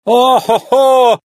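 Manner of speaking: high, drawn-out "oh-ho-ho"; high exultant stage laughter; hearty acted laugh. A narrator's reading. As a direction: whoop a long theatrical laugh. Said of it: "рассмеялся итальянец".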